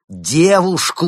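high, loud exasperated shout; boiling over. Acted. Kirill losing patience with the inventor, loud shout. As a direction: snap the word in rising anger. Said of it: "начал закипать Кирилл".

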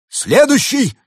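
very high, loud command; commanding shout. A narrator's reading. Winter spirit summons the next wisher, commanding shout. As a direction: call the next one up like an order. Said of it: "Дух зимы скомандовал".

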